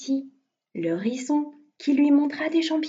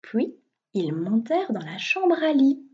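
ordinary delivery

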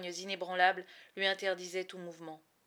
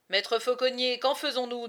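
guards asking; 6 semitones higher, 10 dB louder than usual. Acted. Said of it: "demandèrent les gardes".

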